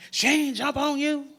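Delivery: nosy counselor. Retold or imitated. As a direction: blurt it like a nosy busybody.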